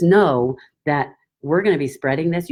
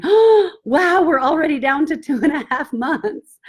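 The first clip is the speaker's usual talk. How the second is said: much higher and louder happy "wow"; imitating delighted surprise; 11 semitones higher, 4 dB louder than usual. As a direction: squeal like a thrilled customer.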